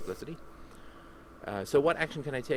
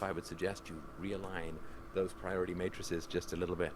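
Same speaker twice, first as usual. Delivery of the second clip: normal talking voice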